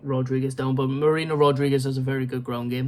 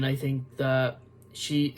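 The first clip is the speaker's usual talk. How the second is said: usual speaking voice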